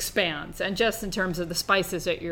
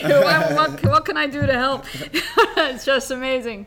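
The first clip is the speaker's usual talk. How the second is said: higher, louder, laughing through the words; 7 semitones higher, 6 dB louder than usual. Real, not acted. Host offering to work for Eric Adams, laughing delightedly. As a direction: giggle as you offer to help.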